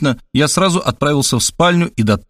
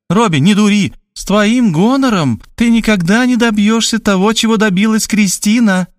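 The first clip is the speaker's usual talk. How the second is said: higher, insistent mother; scolding mother; 10 semitones higher than usual. A narrator's reading. Mother on the phone scolding her son, insistently. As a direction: nag him like an exasperated mum.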